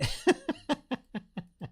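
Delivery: high, rippling laugh; real laughter. Real, not acted. Streamer amused at the stream getting super political, laughing. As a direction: burst out in a long, high chuckle.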